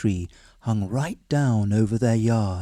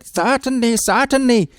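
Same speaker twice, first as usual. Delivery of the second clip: high, louder, hasty assurance; husband's voice; 11 semitones higher, 4 dB louder than usual. A narrator's reading. Husband assuring wife while grabbing more dal. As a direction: agree without meaning it.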